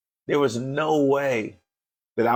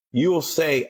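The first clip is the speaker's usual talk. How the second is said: ordinary delivery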